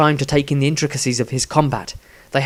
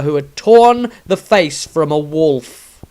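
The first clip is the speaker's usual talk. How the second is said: ordinary talk, nothing marked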